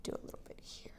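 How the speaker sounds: whispered work aside; whispering. Real, not acted. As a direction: whisper it while adjusting. Adjuster muttering to himself.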